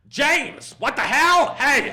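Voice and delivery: put-on high, loud shout in an American accent. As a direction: holler a surprised greeting in an American accent. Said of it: "are you just doing the accent".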